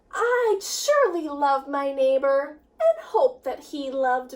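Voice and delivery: lively, sing-song storybook reading; rhyming picture book read with energy; reading to children. A narrator's reading. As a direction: read it with bounce for the kids. Teacher reads picture book aloud.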